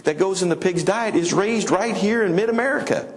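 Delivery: high put-on voice of a customer asking a question; quoting a customer.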